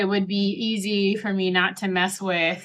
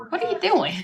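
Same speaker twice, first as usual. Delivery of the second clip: put-on kids' voice; 10 semitones higher than usual. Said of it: "The kids are like".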